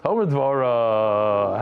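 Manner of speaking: low, very drawn-out mock answer; slow, hammed-up daughter's reply; mock seminary daughter. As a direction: stretch it out like a comic impression. Voicing the daughter's answer, audience laughing.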